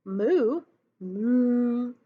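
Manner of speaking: loud mooing cow sound. Shown as a demonstration. Teacher showing the class a cow's loud moo. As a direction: stretch it out like a cow. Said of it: "the actual sound the cow makes".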